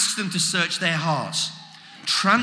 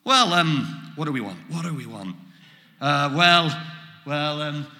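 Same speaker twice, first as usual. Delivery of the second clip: nervous disciples; 2 semitones lower than usual. Pretend. Disciples faced with Jesus, apprehensive and hesitant. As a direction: stall awkwardly, as if put on the spot. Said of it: "apprehensive and get a bit nervous".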